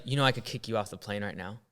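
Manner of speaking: low, soft, lightly played Karen warning; a hint of a bossy Karen asserting dominance. Flight attendant threatening a seated passenger, low soft Karen tone. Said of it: "Karen type of tone".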